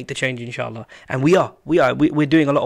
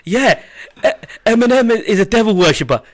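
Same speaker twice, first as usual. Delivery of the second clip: high, loud laughing voice; 9 semitones higher, 8 dB louder than usual. Pretend. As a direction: say it through laughter. Joking hypothetical quote, laughing.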